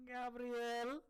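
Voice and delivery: high, loud, flat-out call of the name; friend's name call. Retold or imitated. Friend imitating a classmate yelling a teacher's first name. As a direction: belt the name like he's calling across the gym. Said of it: "Like, straight like that".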